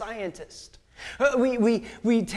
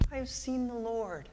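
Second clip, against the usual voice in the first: softer awed claim; 4 semitones higher, 7 dB quieter than usual. Acted. Mary's proclamation again.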